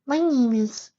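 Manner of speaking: very high, small filly voice; hesitant little filly. A narrator's reading. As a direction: squeak out a tentative introduction as a tiny filly. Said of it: "tried speaking, only found it difficult at first".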